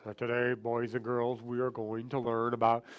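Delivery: very low put-on voice; super low voice. Shown as a demonstration. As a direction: drop far down into a deep voice. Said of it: "they have a super low voice".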